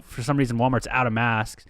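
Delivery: ordinary talk, nothing marked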